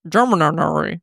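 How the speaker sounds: squawking parrot imitation. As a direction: repeat it like a talking bird. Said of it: "Now you sound like our bird".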